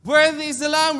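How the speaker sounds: loud, high "Worthy is the Lamb"; preacher showing the right way, loud. Shown as a demonstration. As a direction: call it out full voice. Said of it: "With a loud voice".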